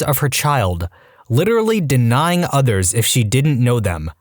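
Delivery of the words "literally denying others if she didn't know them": ordinary delivery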